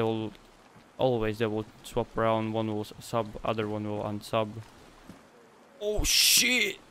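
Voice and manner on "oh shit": high, loud excited outburst; excited yell, pitch leaping up. Real, not acted. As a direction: whoop it out, really thrilled. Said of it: "I'm sorry, I'm excited".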